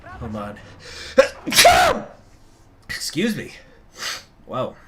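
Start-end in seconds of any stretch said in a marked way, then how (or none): 0.6-2.1 sudden loud real sneeze; sneezing. Real, not acted. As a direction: sneeze sharply. Sneezes repeatedly on stream.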